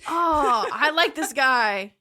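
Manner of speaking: loud, slow delighted. Real, not acted. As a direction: savor it loudly. Delighted reaction to joke.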